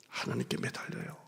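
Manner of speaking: intimate whispered plea. Acted. Pastor urging the congregation in a whisper. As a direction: whisper it like a secret.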